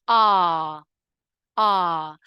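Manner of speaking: loud, held "R" as "aah"; long AH sound. Shown as a demonstration. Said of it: "which is the R sound".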